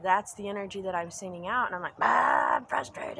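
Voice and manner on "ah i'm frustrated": loud mock yell, dropping into a growl; frustrated outburst. Woman acting out her bad days, frustrated outburst. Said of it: "I'm so frustrated".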